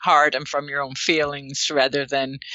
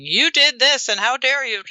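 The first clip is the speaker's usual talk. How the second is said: higher, indignant accusing outburst; attacking accusation; 7 semitones higher, 3 dB louder than usual. Acted. Partner attacking the other instead of sharing feelings, accusing. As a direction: throw it like an accusation, pitched up. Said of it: "rather than attacking them and saying".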